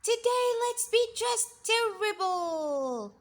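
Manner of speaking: put-on high, loud naughty children's voice; mischievous kids. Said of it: "they said".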